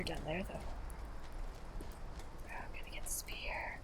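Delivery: hushed scheming whisper; sneaky whisper. Real, not acted. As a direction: whisper like you're sneaking past.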